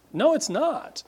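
emphatic high denial, pitch jumping up; indignant correction of the misreading; sharp no. Real, not acted. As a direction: cut the idea off fast. Rebutting the imagined reader.

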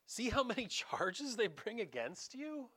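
a touch of a puzzled governor; Pilate asking. Retold or imitated. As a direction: prod him, baffled. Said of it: "Pilate turns to him ... and says".

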